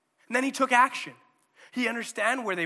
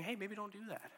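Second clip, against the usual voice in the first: much softer, gentle inner nudge; gentle inner voice warning you off; 3 semitones higher, 13 dB quieter than usual. Acted. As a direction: hush it like a quiet inner prompting. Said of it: "this gentle voice".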